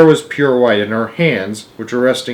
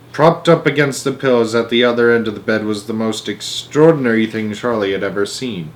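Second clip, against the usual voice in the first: usual speaking voice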